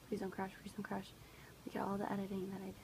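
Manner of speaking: hushed pleading whisper; whisper, willing it not to crash. Real, not acted. Begging the computer not to crash.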